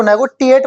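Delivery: नेगोटिएट with a hard T sound mid-word; hard-T नेगोटिएट, as a mistake to avoid; wrong pronunciation. Shown as a demonstration. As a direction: say नेगोटिएट with "ti" where "shi" belongs. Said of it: "नेगोटिएट मत बोलना".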